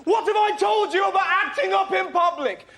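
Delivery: a flustered father reprimanding his son; shrill mock parental scolding; scolding dad. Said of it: "confronted this glowing hell beast and went".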